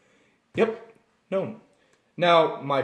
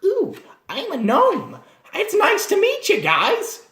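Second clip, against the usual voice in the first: much higher, slow, cutesy small-creature voice; squeaky gnome voice; 3 dB louder, slower than usual. Retold or imitated. New player introducing their gnome to the party, squeaky. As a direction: overplay the tiny adorable gnome. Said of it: "a lot of players when they first start".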